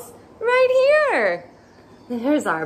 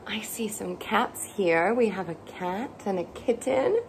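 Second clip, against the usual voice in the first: hushed; 3 semitones lower, 6 dB quieter than usual. Acted. Reading a picture book to toddlers.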